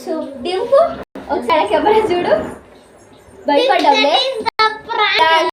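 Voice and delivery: high, loud gleeful shouting; excited prank reveal. Real, not acted. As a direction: crow over the scared victim.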